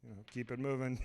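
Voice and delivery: soft, low, understated brush-off; imitated Ryan Gosling. Movie star politely sending fans on their way, hushed and low. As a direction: turn them away quietly in a low voice. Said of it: "And he's like".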